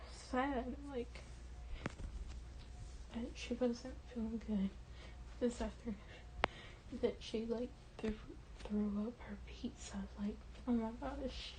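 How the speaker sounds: very soft, upset and holding it in; getting emotional. Real, not acted. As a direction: let the voice sink with real sadness. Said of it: "Sorry, I'm getting emotional".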